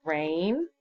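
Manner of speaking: ordinary talk, nothing marked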